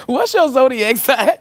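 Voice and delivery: high, loud, drawn-out question; playful holler. Pretend. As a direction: belt the question out slowly, mock-bold.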